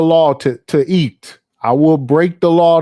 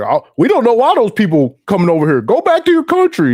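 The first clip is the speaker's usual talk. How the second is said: mock scolding; 8 semitones higher than usual. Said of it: "you just be like".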